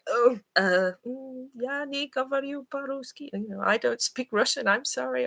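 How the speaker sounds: high, hesitant apology in broken Russian; flustered foreigner. Retold or imitated. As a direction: play yourself caught off guard, apologising. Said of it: "be surprised when I".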